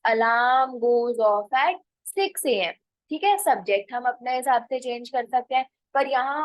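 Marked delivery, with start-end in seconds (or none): 0.1-2.7 very slow, word by word; teacher dictating an example sentence; slow model sentence. Shown as a demonstration. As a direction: spell it out slowly for learners. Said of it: "तो मैं बोलूंगी नेहा".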